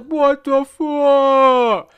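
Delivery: drawn-out, high, loud comic holler; acted-out disbelieving wail, very slow; slow-motion yell. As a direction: stretch each word as if falling in slow motion. Retold slow-motion fall, betrayed by instructor.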